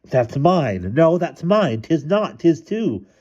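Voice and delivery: high back-and-forth bickering; insistent high-pitched sibling argument; children squabbling. A narrator's reading. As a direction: trade high, stubborn kid retorts.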